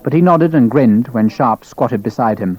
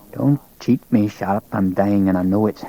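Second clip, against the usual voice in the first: put-on thicker Scottish accent; 3 semitones lower, 4 dB quieter than usual. A narrator's reading. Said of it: "His Scottish accent was thicker".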